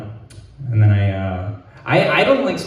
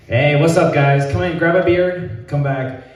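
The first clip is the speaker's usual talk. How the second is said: mock promoter call, a touch higher; barker voice; 5 semitones higher than usual. Comic parodies himself calling passersby in, mock promoter. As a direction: hype it up like calling in a crowd. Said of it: "I should have been".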